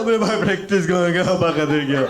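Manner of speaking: loud, wailing mock sob; hammed-up weeping kid; imitating crying. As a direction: blubber the question out. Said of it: "वो रोते रोते बोल रहा है".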